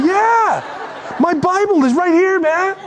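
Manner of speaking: put-on young congregant voice. Congregant shows pastor the phone Bible, high and eager. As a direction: chirp it up like an eager kid.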